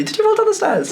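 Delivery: surprised. Real, not acted. Shocked question, far higher.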